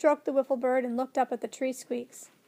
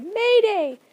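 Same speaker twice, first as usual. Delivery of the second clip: loud, high alarm call; bird's cry; 9 semitones higher, 9 dB louder than usual. A narrator's reading. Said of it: "the wiffle bird cried".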